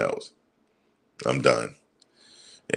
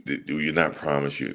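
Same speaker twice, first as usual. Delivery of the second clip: ordinary delivery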